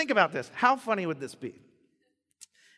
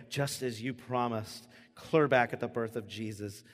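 prayer; 5 semitones lower than usual. Shown as a demonstration. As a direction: pray it sincerely and unhurried. Pastor demonstrates a proper prayer.

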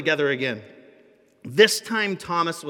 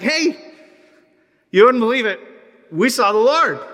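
louder, higher, bursting with the news; excited boasting; 6 semitones higher, 8 dB louder than usual. Retold or imitated. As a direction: shout the big news to the latecomer. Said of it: "I hope they weren't boasting".

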